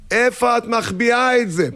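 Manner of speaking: high, sustained complaining holler; exasperated calling. Acted. Husband accusing wife of hiding formula, high exasperated call. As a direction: holler an accusation across the house. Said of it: "עכשיו צעקה שתיים".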